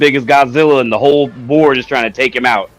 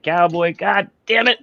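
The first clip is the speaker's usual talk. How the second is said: mock exasperated cry, pitched up; retold exclamation; 4 semitones higher, 4 dB quieter than usual. Host retelling his reaction to Cowboy's game, mock exasperation. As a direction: curse playfully at a friend.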